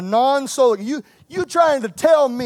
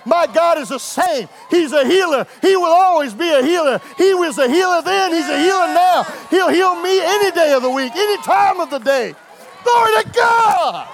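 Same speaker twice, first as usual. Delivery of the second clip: higher, shouted preaching cry; preaching at full force; 5 semitones higher, 3 dB louder than usual. Acted. Preacher crying out God's promise to the church. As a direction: call it out to the congregation.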